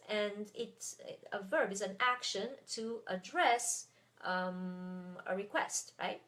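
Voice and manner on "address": adDRESS, accent on DRESS; stress on last syllable. Shown as a demonstration. Teacher using the verb in an example, end stress on address. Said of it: "is stressed at the end".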